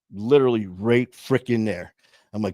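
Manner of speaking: ordinary talk, nothing marked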